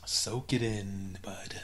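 breathy slow whisper. Pretend. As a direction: whisper it slowly, teasing. Mock sultry urging over picture.

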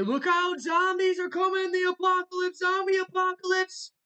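shouted high warning, hammed up; mock alarm. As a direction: cry out like a TV doom announcer. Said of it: "And they say".

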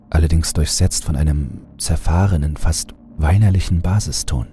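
ordinary delivery

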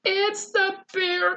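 high, loud cry of alarm; frightened cry. Acted. Eddie spotting the hungry bear, frightened cry. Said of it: "cried Eddie".

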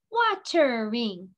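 "Watering" slowly, in three beats: wa-ter-ing; split into syllables. Shown as a demonstration. Teacher touching the head for each part, "Watering" syllable by syllable. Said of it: "Let's do it again".

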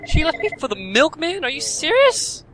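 hammed-up betrayed disbelief; mock outrage. Real, not acted. Gamer reacting to wife leaving for milkman, mock outrage.

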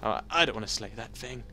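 ordinary delivery

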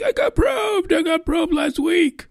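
high, shrieking mock abductee yell. Mocking people claiming alien abduction, high shrieking mock yell. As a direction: shriek it like a hysterical probe victim. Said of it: "people who are saying".

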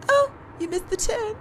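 high, shaky, rising panic; nervous panic. Acted. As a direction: babble in rising fear. Realizes the driver is the killer.

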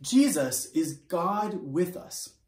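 usual speaking voice

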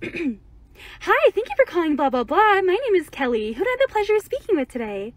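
bright, polite phone agent greeting; call center voice. Retold or imitated. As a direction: sound like a cheerful call center rep. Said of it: "I'm gonna do my call center voice".

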